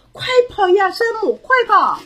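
high, loud cry of warning; urgent shout. A narrator's reading. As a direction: shout to get Sam running. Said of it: "史黛拉大叫". (高声急切地大叫)

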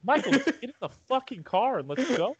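mock exasperated. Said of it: "I was like".

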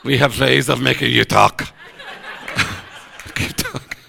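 interrogator's menace, softly; cool foreign spy menace. Pretend. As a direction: play the sinister spy, half joking. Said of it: "This light's getting nervous".

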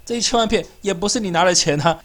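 ordinary delivery

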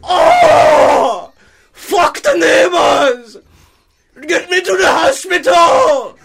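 high, loud, crying yell; screaming. Acted. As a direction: scream for the hospital. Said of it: "my dad's still screaming".

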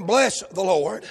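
slow down on Bless; slow stressed bless. Shown as a demonstration. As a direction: Bless drawn out, rising. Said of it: "Notice the emphasis".

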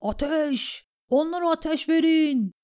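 high, loud shouted order; battle command. A narrator's reading. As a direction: order archers to fire. (yüksek sesle savaş emri)

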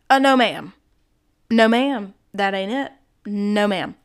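loud, emphatic refusal at first, then firm; indignant scolding; firm rebuke. Real, not acted. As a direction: shut the claim down firmly. Firmly rebuking the attorney's quote.

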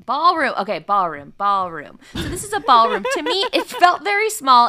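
loud, emphatic disbelief; incredulous. Real, not acted. Host doubting the tiny room counts as a ballroom, loudly incredulous. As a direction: hit "ballroom" loudly, as if questioning the very word.

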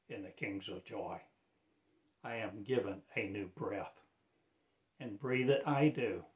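usual speaking voice

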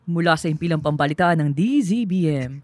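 formal broadcast read; radio news announcer. Shown as a demonstration. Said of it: "Sample nga, sample".

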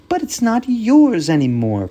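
put-on high, loud child protest; protesting girl. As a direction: object like an indignant kid. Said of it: "protested Karen".

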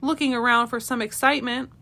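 ordinary delivery

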